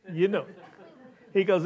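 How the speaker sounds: ordinary talk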